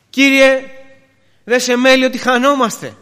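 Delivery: high, loud cry for help; loud, pleading protest; desperate cry. Acted. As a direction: call out to the Lord as if drowning. Disciples' desperate cry, first take.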